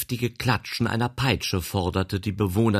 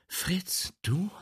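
high, breathless surprise; surprised cry; 13 semitones higher than usual. Acted. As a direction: gasp his name. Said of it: "rief sie".

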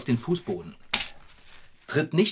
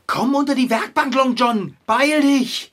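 high, loud urgent command; urgent urging; 10 semitones higher, 7 dB louder than usual. Acted. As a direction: hurry the cat along, frantic. Drängt den Kater. (drängend, hoch und laut)

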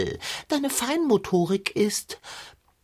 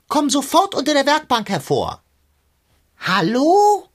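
calling out; 6 semitones higher, 5 dB louder than usual. Acted. Calling him out from under workbench.

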